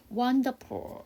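WONDERFUL drawn out slowly as a pronunciation model; teacher sounding out "wonderful". Shown as a demonstration. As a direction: say "wonderful" slowly for learners, syllable by syllable. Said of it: "L 사운드는 리을".

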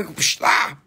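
psh then a short mock yelp; mock swat and cry. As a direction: swat the witch, she yelps. Witch struck from the air.

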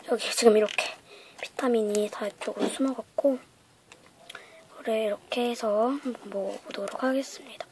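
hushed, unvoiced whisper; soft whisper. Real, not acted. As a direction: breathe the words softly. Whispered run before tasting.

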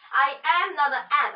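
loud indignant protest. A narrator's reading. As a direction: insist hotly, offended. Boy insists he is no ant.

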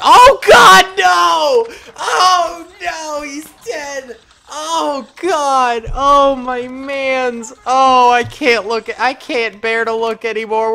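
hammed-up cries of grief, loud and high; mock anguish. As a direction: wail over the fallen soldier. Soldier he named dies.

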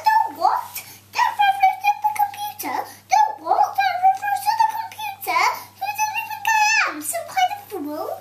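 very high and fast, giggling; squeaky high voice. Acted. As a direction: squeal it with a laugh. Silly high voice, giggling through.

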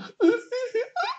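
very high mock giggle; stupid laugh. Mocking Greek God X riding clout, stupid high laugh. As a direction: imitate an idiotic laugh, squeaky high. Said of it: "while laughing all stupid".

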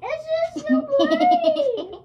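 slow, laughing complaint; laughing while half-blinded by spray. Real, not acted.

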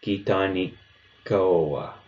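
"Kitani koa" with the K held a beat before release; teacher repeating "Kitani koa" with a held K; held double K. Shown as a demonstration. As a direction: linger on the K of "Kitani", then "koa". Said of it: "holding the K sound just a little bit longer".

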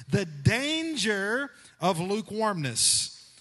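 loud, slow, ringing sermon line; impassioned preaching. Acted. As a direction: proclaim it to the whole church. Preacher builds to theme.

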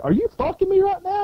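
high-pitched incredulous challenge; retold outrage. Marine confronts a man posing as one, outraged. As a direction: act out indignant disbelief, voice jumping way up.

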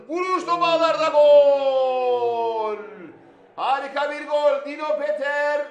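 drawn-out goal scream, then excited call; screaming football commentator. Real, not acted. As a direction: roar the goal and keep the excitement.

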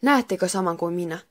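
plain reading voice

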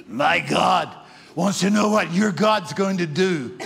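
loud, eerie demon-possessed voice; spooky voice. Retold or imitated. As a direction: boom it out like a possessed man. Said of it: "here's what he said in a spooky voice".

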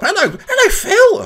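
high, loud cheery doctor greeting; doctor arriving. Pretend. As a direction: burst in as an overly cheerful doctor. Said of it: "Doctor Benchy turns up".

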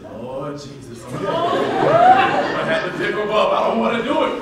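laughing exasperation. Retold or imitated.

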